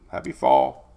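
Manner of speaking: mock deep-voiced greeting; deep joke voice. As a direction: drop way down for a silly Happy fall.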